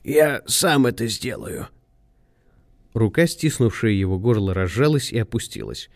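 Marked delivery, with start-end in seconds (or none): 0.0-1.7 slow, deliberate, composed statement; cool, measured resolve; imperturbable. A narrator's reading. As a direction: state it calmly and without hurry. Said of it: "невозмутимо сказал он".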